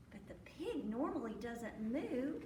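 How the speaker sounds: ordinary delivery, nothing marked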